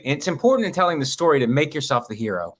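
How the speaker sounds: ordinary talk, nothing marked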